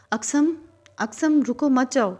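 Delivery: high, loud desperate scream; screaming with full force. A narrator's reading. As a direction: scream after him not to leave. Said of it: "پوری قوت سے چلا رہی تھی".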